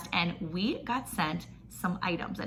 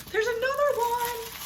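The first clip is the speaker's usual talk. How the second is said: high surprised squeal; delighted squeal. Real, not acted. Finding another towel in the box, squealing in surprise. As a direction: squeak it out, thrilled at the find.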